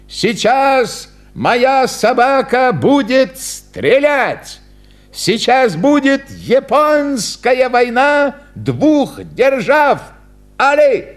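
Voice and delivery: hammed-up circus barker; showman announcing. A narrator's reading. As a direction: build it up for the crowd. Clown announces the shooting act.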